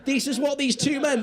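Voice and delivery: usual speaking voice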